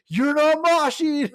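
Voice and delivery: high, loud, drawn-out mock shout; imitated frontman's scolding yell. Frontman to a crowd standing still, scolding yell. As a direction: holler it like a frontman calling out slackers.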